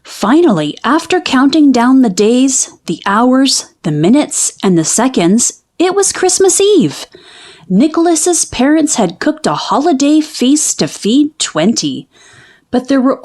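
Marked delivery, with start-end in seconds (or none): none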